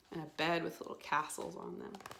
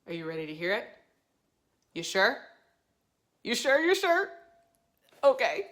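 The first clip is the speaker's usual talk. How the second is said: teasing; 12 dB louder, faster than usual. Pretend. Teasing the kids before story.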